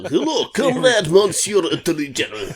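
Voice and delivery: put-on loud French accent sliding into Russian, half laughing; hammed-up Franco-Russian accent; botched French-Russian accent. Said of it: "it's coming out half Russian on me".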